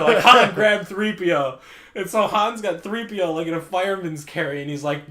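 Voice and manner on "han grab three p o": mock shout. Calling to Han mid-fight.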